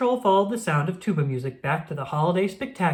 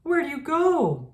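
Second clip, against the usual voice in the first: worried call; 10 semitones higher than usual. Acted. Captain searching for penguins.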